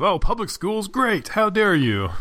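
high, mocking outraged voice; hammed-up indignant backlash; mock outrage. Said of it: "that big backlash of".

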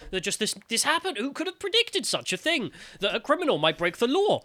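high-pitched feigned disbelief; sarcastic, exaggerated surprise; mock astonishment. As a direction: act shocked by the entirely predictable. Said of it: "we act as if it's an act of God".

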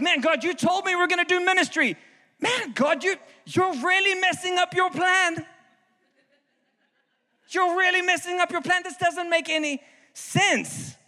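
high, exasperated pleading; frustrated at God. Retold or imitated. Pastor reliving his rant at God, high and exasperated. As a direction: throw the complaint up at God.

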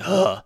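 mock irritated employee grunt; annoyed groan. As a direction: groan like you're sick of this customer. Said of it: "and she's like".

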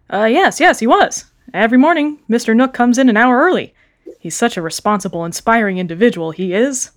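flustered lying shopkeeper; nervous sea otter. Acted. Panicking otter lies.

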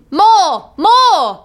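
high-pitched giant's squeal. A narrator's reading. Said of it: "the giant squealed".

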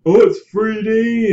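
high slow mocking gush; sarcastic high enthusiasm; mocking hype voice.

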